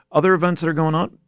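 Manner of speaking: talking through hiccups; hiccupping mid-sentence. Real, not acted. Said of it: "I am got the hiccups all of a sudden".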